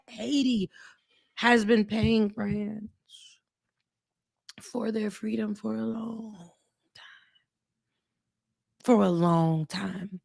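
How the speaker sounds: slow, hushed and heavy; solemn, quiet. Real, not acted. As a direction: let each word sink in, softly. Solemn point about paying France.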